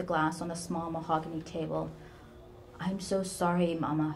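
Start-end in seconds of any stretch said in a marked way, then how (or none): none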